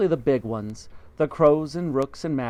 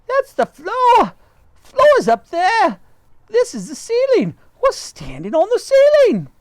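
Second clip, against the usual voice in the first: very high, much louder shrieking; wife shrieking they stand on the ceiling; panicked scream; 10 dB louder than usual. Acted. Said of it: "screamed Miss Twit".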